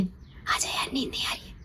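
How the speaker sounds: unvoiced, pleading whisper. Boyfriend begging her to come over, whispered. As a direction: coax her over, barely breathing it. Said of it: "खुसुर फुसुर".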